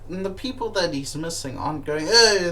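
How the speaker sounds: usual speaking voice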